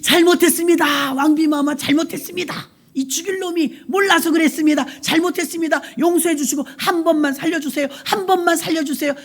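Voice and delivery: high, pleading grovel; begging Haman. Acted. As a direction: beg the queen for your life. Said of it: "에스더한테 싹싹 비는 거예요".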